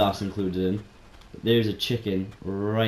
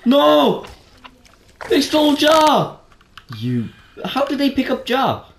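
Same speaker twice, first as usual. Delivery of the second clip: dismayed shout; 9 dB louder, slower than usual. Real, not acted. Pet dodo snatched by seagulls.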